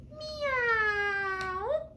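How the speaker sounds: high, drawn-out meow; cat's meow. A narrator's reading. Puppy George answers his mother with the wrong sound, a cat's meow. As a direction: meow like a cat, long and high. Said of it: "And George said".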